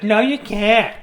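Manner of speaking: high, loud mom refusal; scolding mom. Acted. Mom forbidding juggling practice, scolding.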